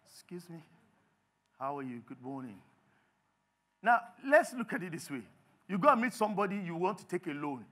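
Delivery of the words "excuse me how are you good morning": soft, low, composed hello; quiet, restrained courtesy; polite formal greeting. Acted. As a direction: greet them discreetly, very reserved. Polite greeting after loud one.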